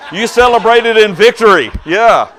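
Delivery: loud, high, exultant call; big celebratory shout; triumphant cheer. Real, not acted. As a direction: crow about the victory. Teasing cheer about game win.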